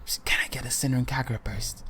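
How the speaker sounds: secretive whisper, repeated; hushed, furtive request. Retold or imitated. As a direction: keep it under your breath, barely voiced. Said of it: "he's whispering to the guy".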